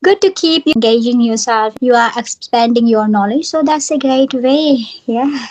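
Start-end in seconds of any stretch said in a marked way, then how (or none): none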